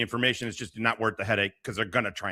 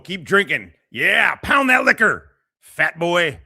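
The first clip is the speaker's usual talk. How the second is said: loud, higher egging-on shout; mock cheering; 6 semitones higher, 8 dB louder, slower than usual. Taunting a man drinking on stream, loud mock cheer. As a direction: hype him up like a rowdy crowd.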